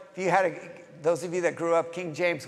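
normal talking voice

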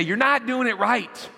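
high-pitched mock reprimand; exaggerated exasperated parent; mock scolding dad. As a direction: scold a toddler, hammed up. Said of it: "scold her and look at her and say".

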